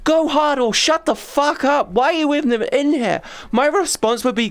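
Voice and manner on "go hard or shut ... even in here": mock outraged yell. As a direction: yell it at the health nut, fed up. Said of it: "I'm like".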